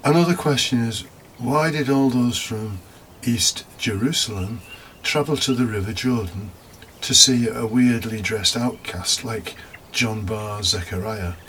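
normal talking voice

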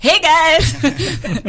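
high, drawn-out hello with a laugh; oblivious cheery greeting. Retold or imitated. Late arrival greeting drinking crew anyway, cheery. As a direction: repeat the greeting high and chipper, amused. Retold greeting repeated despite bad news.

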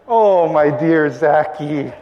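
slow, drawn-out endearment; amorous wife. Acted. Elizabeth to her husband after the bedroom hint, playfully amorous. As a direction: coo it slowly, sliding down in pitch.

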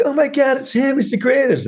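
very high-pitched hammed-up fan gushing; mock adoring fans. Students seeing their debate hero arrive, mock starstruck. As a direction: imitate a starstruck crowd, high and squeaky. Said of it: "everyone's like".

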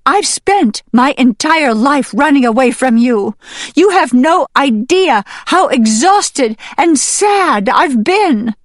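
high, loud, impassioned outburst; anguished hen. Acted. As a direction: let years of exhaustion burst out. Hen pours out her grievance.

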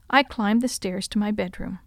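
ordinary delivery, nothing marked

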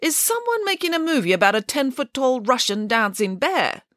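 high-pitched, doubtful questioning; suspicious. A narrator's reading. Nanny Piggins questions the film offer, suspicious. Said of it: "asked Nanny Piggins suspiciously".

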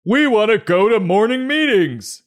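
very high, loud pleading voice; hammed-up whining adult; whiny grown-up. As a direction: beg like a sulking child.

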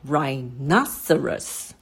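slow teacher's model of rhinoceros, stressed NO; stress on second syllable. Shown as a demonstration. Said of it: "它的重音其实是在".

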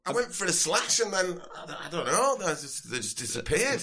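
hammed-up sozzled Yorkshire lad; drunk Yorkshireman. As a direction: drunk Yorkshire festival-goer demanding a wristband. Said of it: "determined slasher from Yorkshire".

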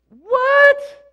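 high, loud shocked cry of What; high startled exclamation. Acted. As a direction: blurt it out in disbelief. Shocked reaction to the verse.

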